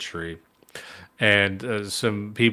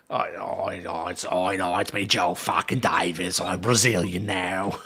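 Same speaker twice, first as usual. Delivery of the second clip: put-on Brazilian-Australian accent; 2 semitones lower than usual. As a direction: drag out a comic Aussie accent as a supposed Brazilian. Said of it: "a Brazilian with an Australian accent".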